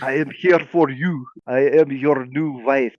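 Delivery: hammed-up Eastern European accent, slow; slow, amateur Eastern European accent; thick Eastern European accent. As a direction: play a bride with a clumsy Eastern European accent. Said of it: "she says in a thick European accent".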